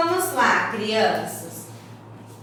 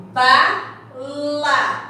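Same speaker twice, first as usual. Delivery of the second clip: "Bala" split into two syllables, Ba, pause, la. Shown as a demonstration. Teacher counts two syllables for the children, "Bala" broken apart. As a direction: say "Bala" slowly, a clear gap between ba and la. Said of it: "eu tenho aqui duas sílabas".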